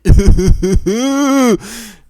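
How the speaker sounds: a loud, high burst of laughter. Real, not acted. Laughing at his own ransom joke, loud high burst. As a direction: crack up out loud.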